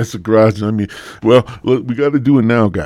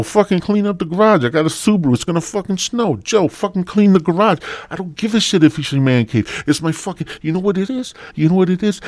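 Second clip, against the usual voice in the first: higher-pitched, fed-up imitation of a wife; nagging angry wife; 7 semitones higher than usual. Wife ordering Joe to clear the garage, mock furious. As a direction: scold like a wife at the end of her patience.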